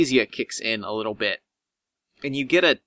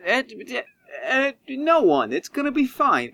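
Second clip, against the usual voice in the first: much higher, hurried reassurance; nervous high Cook; 9 semitones higher than usual. Acted. Nervous doctor dodging the player's question, high and fluttery. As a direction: fluster and cover a lie in a high voice.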